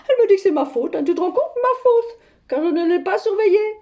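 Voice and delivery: high-pitched, incredulous outrage; indignant. Acted. S'indigne d'être accusée par sa fille. (indignée, la voix qui monte)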